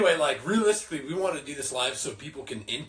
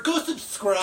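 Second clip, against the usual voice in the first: nerdy YouTuber; 7 semitones higher, slower than usual. Pretend. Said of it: "I sound like a nerdy YouTuber".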